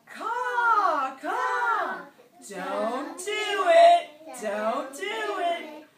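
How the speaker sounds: very loud, high caw, stretched out slow; big swooping squawk, drawn-out words; fish eagle call. Acted. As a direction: screech it like a bird's warning. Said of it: "the fish eagle comes by and says".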